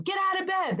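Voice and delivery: acted-out nagging parent; parent's wake-up call. Parent rousing a sleeping teenage boy, mock nagging.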